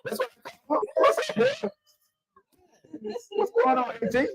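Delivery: squeaky excited hype voice; hyped high greeting. Pretend. Hyped greeting of arriving guest.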